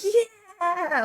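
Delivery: high-pitched mock yell at no extra volume; imitating gleeful yell. Sledder yelling down the hill, high mock yell. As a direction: stretch it out like a held-back holler. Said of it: "I was yelling in English".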